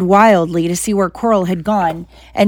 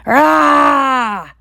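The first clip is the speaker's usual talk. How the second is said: pained cry; 7 semitones higher than usual. Acted. Quirrell screams as Harry grabs his face.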